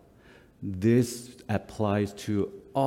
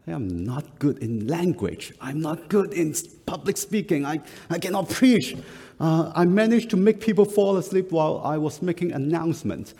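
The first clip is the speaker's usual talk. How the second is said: a higher, protesting younger self; lightly voiced younger self objecting, higher; pleading excuse; 8 semitones higher than usual. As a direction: plead with God, rising in pitch. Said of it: "my response to him was".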